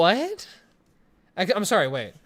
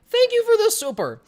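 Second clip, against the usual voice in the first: excited thanks; 6 dB louder than usual. Real, not acted. Thanking a donor on stream.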